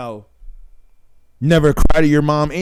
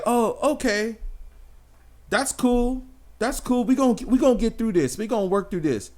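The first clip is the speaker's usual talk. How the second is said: higher, softer, falsely sweet; mock understanding wife; 6 semitones higher, 6 dB quieter than usual. Wife hears her man lost his job, sweet and higher. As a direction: play the too-calm supportive woman. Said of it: "she'll be like".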